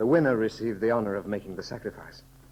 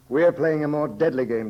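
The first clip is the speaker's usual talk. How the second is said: higher and louder, pressing the point; raised dramatic emphasis; 4 semitones higher, 6 dB louder than usual. Acted. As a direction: push the words hard at him. Tense wager over a deadly game.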